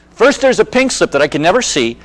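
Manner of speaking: ordinary delivery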